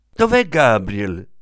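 anxious aunt calling loudly; shouting. A narrator's reading. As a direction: shout for Gabriel in a panic. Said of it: "gridò".

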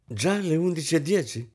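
high exclamation of polite surprise; exclaiming. A narrator's reading. Guest remarking on the late hour, exclaiming. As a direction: exclaim lightly at the time. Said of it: "esclamò la signorina Isabel".

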